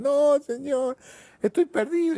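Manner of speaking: very high, loud wail; a shrill, desperate protest to God; desperate insistence. Acted. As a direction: cry out in despair. Sailor insists he is lost.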